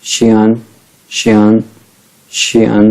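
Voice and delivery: शियान said as "shee-an"; say xian. Shown as a demonstration. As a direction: model शियान clearly. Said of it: "x आई इसके बीच में".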